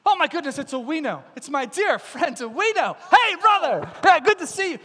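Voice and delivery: high, loud, gushing hello; overjoyed greeting. Acted. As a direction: hail a dear friend with big delight. Overjoyed greeting of dear friend.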